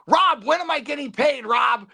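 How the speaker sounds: high, nasal-free pleading demand; whiny demand. Retold or imitated. Perry demanding pay from Rob on arrival, high whiny demand. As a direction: demand your pay like a pest. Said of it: "and it goes".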